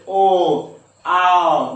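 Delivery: "ओ" slow and rounded, then "आओ" loud; vowel sound demo. Shown as a demonstration. Teacher showing the throat-and-lips vowel, slow then loud. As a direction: draw out "ओ", then call "आओ". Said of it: "जैसे बोले".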